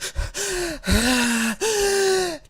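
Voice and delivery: a big exaggerated high-pitched exhale; strained high sigh. Patient at the doctor acting out a frantic exhale. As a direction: blow out a panicked breath, way up high.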